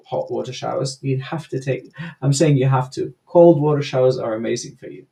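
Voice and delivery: ordinary delivery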